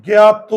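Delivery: জ্ঞাত said loud with jaw kept closed, o ending; closed jaw demo. Shown as a demonstration. Teacher to pupils, loud jaw-closed জ্ঞাত demo. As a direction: bark জ্ঞাত out, jaw shut. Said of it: "মুখের চোয়াল খুলে না".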